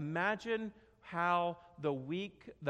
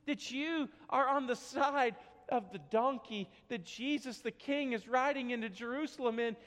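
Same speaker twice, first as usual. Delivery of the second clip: usual speaking voice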